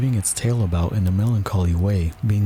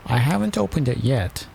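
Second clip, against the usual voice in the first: high-pitched royal attendant; 5 semitones higher than usual. A narrator's reading. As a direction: pipe up high to the king.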